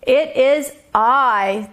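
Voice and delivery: slow, booming third billy goat; big goat's loud storybook voice. A narrator's reading. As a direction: announce yourself big and slow. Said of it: "his voice was big and loud".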